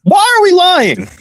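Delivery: high, drawn-out exasperated question; exasperated disbelief. Real, not acted. Co-host rejecting the coincidence as proof, exasperated. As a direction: stretch the accusation out in disbelief.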